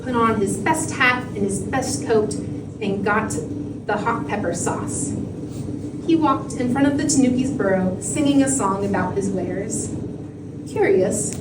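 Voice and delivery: ordinary delivery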